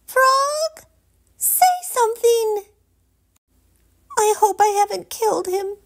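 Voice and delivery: very high, anxious and fast; panicked princess. A narrator's reading. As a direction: beg the frog to answer. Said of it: "she prayed".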